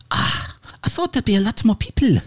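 put-on high, quick, croaky old woman's voice; croaky old lady. GM voices the flustered lady, high and croaky. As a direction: cackle it out like an old crone. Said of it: "just do a croaky voice".

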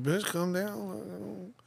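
slow, high, put-on sassy voice; mock diva drawl. Host mocking his overexcited friend, slow and sassy. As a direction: drag it out like a diva telling someone off.

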